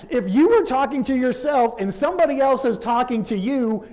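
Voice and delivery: loud, high, emphatic declaration. Real, not acted. Hammers home the key point.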